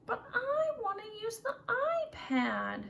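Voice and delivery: put-on pouty child whining for the iPad; whiny child. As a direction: whine like a kid denied. Child whines after told no.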